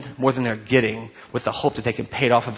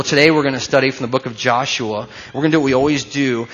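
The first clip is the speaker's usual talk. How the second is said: ordinary talk, nothing marked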